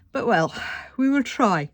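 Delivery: words pulled long by a yawn. Real, not acted. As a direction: say it through a yawn. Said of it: "Oh dear, excuse me for yawning".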